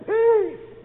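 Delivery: high, loud, drawn-out command; commanding cry. Acted. Man in the boat stilling the storm, commanding high shout. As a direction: throw the word out over a storm.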